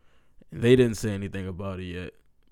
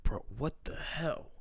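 whispered disbelief, higher than usual; stunned whisper; 5 semitones higher, 7 dB quieter than usual. Retold or imitated. Speaker recalling his reaction to the crash news, stunned whisper.

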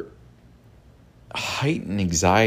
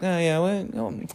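cool, unbothered cartoon rabbit, pitched higher and softer; calm Bugs Bunny; 10 semitones higher, 6 dB quieter than usual. Bugs Bunny calmly defusing an angry foe, mock voice. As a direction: brush it off like a cartoon trickster. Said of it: "real calm".

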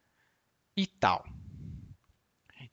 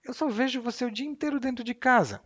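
scolding; 10 semitones higher than usual. Acted. Acted scolding in dialogue.

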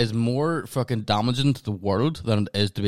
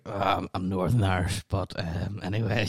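awkward mumbled reply; 3 semitones lower, 5 dB quieter than usual. Retold or imitated. Acting out own awkward reply.

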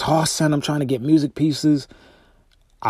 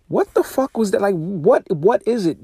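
baffled; 7 semitones higher than usual. Retold or imitated. Asking why gear failed.

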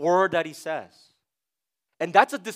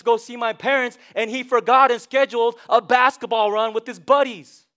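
mock exasperated wife; 5 semitones higher, 7 dB louder than usual. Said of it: "Betty's like".

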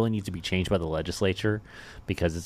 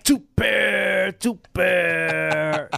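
high, loud, mocking "too bad" like a movie bad guy; Training Day villain; 10 semitones higher, 5 dB louder, much slower than usual. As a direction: sneer it like a tough cop gloating. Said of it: "he probably watched Training Day recently".

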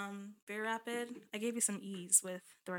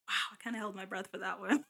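ordinary talk

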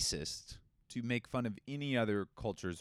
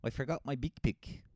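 mock New Zealand accent, a touch higher; 5 semitones higher than usual. Shown as a demonstration. Host mocks the New Zealand accent, lightly voiced. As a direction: play a Kiwi who lost the big pick. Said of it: "That's not how they say it".

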